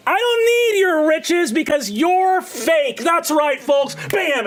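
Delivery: high, brisk carnival barker pitch; gnome showman. Acted. Gnome illusionist hawking to the market crowd, high showman voice. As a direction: hype the crowd like a cocky street magician.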